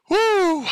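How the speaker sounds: high, loud whoop; showy high-pitched woo; exaggerated breath whoop. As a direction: whoop it out after a big gulp of air. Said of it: "Sucking in that oxygen".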